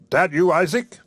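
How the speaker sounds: mildly smug preacher; a touch of calm self-satisfaction; complacent. Acted. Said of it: "he said complacently".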